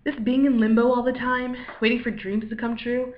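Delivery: ordinary talk, nothing marked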